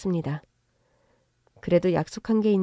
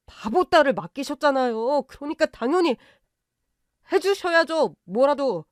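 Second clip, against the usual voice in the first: brazen in-law; 6 semitones higher than usual. Acted. In-law brazenly demanding help.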